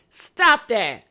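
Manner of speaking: ordinary talk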